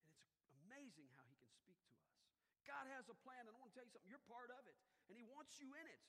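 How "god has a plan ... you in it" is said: loud, higher, building declaration; preaching climax. Acted. Pastor assuring the congregation God is for them, fervent. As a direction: lift them with the promise.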